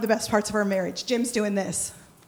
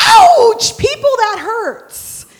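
loud, high yelp, then slower; pained outcry; 9 semitones higher, 8 dB louder, much slower than usual. Acted. Preacher stung by love-your-neighbor verse, high loud yelp.

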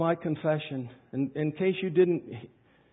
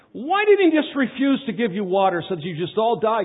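ordinary delivery

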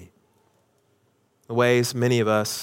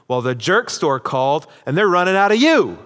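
acted triumphant comeback, rising higher and louder; George Costanza; 4 semitones higher, 4 dB louder than usual. George hitting back at the coworker, mock-triumphant zinger. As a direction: build to a big, high punchline on the last word.

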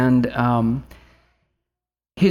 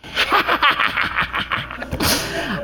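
put-on high breathless giggling cackle of a little boy; son's cackle laugh, copied by dad; mischievous cackle laugh; 12 semitones higher than usual. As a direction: imitate a four-year-old's joyful, mischievous cackle. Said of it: "he's got this, like, cackle laugh".

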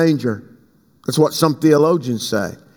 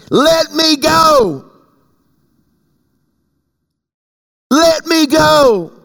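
high, drawn-out cry of demand; commanding shout; 9 semitones higher, much slower than usual. Acted. Angel to Jacob as day breaks, commanding shout. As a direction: call it out high, each word stretched.

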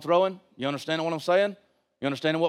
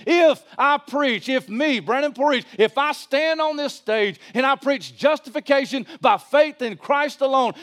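high, emphatic preacher's voice; forceful sermon declaration; impassioned preaching; 8 semitones higher, 4 dB louder than usual. Real, not acted. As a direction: drive each phrase home from the pulpit.

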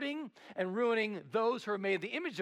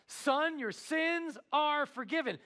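Son, your sins are forgiven, higher and louder, like a proclamation; proclaimed loudly; 9 semitones higher, slower than usual. Acted. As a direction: call it out to the whole room. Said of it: "He heals his spiritual condition".

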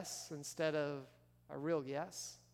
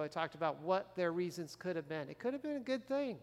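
ordinary talk, nothing marked

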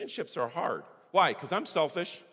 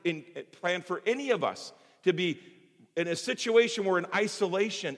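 ordinary delivery

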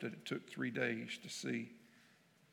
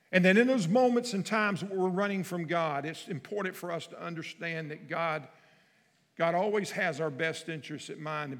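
loud, driving sermon voice; preaching at full force; 4 semitones higher, 7 dB louder than usual. Acted. Preacher pushing hard to his congregation, forceful. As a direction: press the point home to the church.

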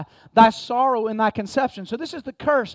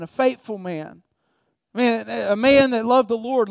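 ordinary talk, nothing marked

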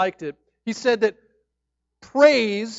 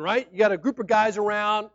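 normal talking voice

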